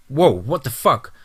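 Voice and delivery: slow, startled exclamation; yelled. A narrator's reading. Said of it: "Tyler yelled".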